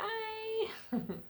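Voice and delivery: high laugh. Real, not acted. Laugh after not wanting goodbye.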